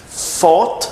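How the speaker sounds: "Fought" with clear F, high rising tune; F sound. Shown as a demonstration. Teacher recaps all the words, "Fought" with F, rising. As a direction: start "Fought" on F and lift the end. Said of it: "Com som de F".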